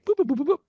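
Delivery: quick, high "boop" phone tones; string of light spoken beeps; imitated dialling beeps. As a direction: mimic punching in a phone number. Mimics dialling before a nervous call.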